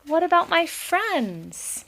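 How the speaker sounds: put-on high pleading child voice; a little one begging Ma; another child. A narrator's reading. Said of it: "said another".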